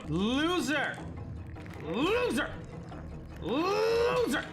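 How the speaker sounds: taunting yell. Real, not acted. Player jeering at the defeated enemy. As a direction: jeer at the beaten foe.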